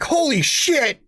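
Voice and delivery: high startled manager voice; high mock alarm. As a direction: play the panicked boss running out. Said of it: "he's like".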